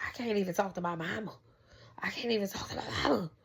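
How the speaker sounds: fast, frustrated whisper to herself; whispered childhood gripe about mom. Retold or imitated. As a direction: hiss the complaint under your breath like a fed-up kid. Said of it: "and I'd be like".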